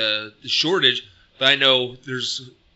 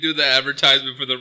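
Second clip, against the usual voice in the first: ordinary talk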